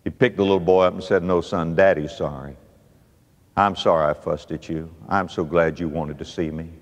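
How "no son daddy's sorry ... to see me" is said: very low, soft apology; hushed, sorry daddy; heartbroken tender father. Acted. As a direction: comfort the little boy softly, deep in your voice. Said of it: "That broke the daddy's heart".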